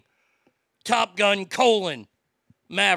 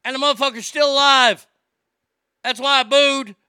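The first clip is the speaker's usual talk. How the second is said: louder, faster, higher rant; exasperated outburst; 5 semitones higher, 8 dB louder than usual. Real, not acted. Host venting to listeners about the Halloween sequel, loud exasperated outburst.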